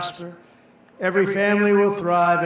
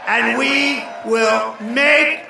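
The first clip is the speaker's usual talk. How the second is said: shouted rally refrain, rising and slower; rally speech; 3 semitones higher, 3 dB louder than usual. Real, not acted. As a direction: roar each line at the crowd.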